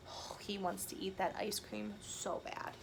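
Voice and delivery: soft whispered aside; hushed narrator aside. Real, not acted.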